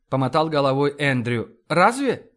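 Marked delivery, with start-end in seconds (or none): 1.7-2.3 higher-pitched quick question; surprised. A narrator's reading. Linda questioning Andrew's indifference, surprised. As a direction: react in mild astonishment. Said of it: "удивилась Линда".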